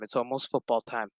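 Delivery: ordinary delivery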